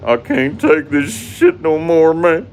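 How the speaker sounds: very high, loud, slow whimpering wail; high loud mock whimper; Wings of Redemption whimper. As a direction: whine it like a breaking cry. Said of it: "Wings of Redemption's famous".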